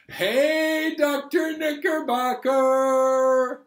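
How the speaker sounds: very high and loud, calling out to start the song; squeaky puppet shout. Acted. Puppet calling out to start the song, high excited shout. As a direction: puppet yelling with glee.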